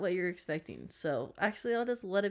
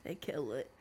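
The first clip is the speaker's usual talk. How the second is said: whispering; 5 semitones lower, 6 dB quieter than usual. Real, not acted. Whispered echo of the joke.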